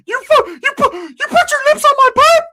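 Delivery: high, loud laughing squeal; Ricky, shrieking. Retold or imitated. Ricky yelling at the guy on the ground, laughing high squeal. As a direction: squeal it in disbelief through laughter. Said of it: "Ricky's like".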